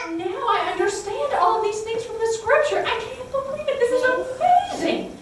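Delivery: overjoyed, can hardly believe it; joyful amazement. Acted. Disciples realizing the scripture's meaning, laughing excited disbelief.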